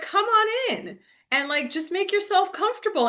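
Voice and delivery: lightly voiced hospitable host; friendly farmer. Voicing friendly old farmer.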